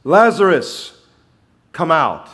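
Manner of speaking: high, loud summons; loud call. Acted. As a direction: call him out of the tomb. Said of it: "called in a loud voice".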